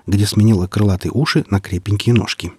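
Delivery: usual speaking voice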